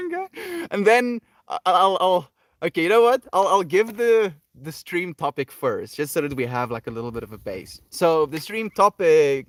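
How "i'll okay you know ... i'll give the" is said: ordinary talk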